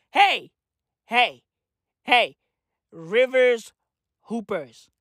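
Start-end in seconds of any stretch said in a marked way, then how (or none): none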